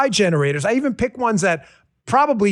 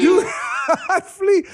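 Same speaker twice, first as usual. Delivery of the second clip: mock movie shout; 7 semitones higher than usual. Echoes the movie clip, laughing.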